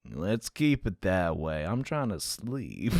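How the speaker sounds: low, slow cowboy drawl; cowboy accent. Acted. Cowboy officer trying to sleep, slow cowboy accent. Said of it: "My cowboy accent sucks".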